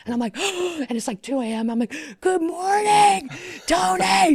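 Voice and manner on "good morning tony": high, loud, slow squealed greeting; excited scream. Retold or imitated. Fan greeting Tony on the call at two AM, excited high scream. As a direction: scream it down the phone with joy. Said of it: "I'm screaming in the phone".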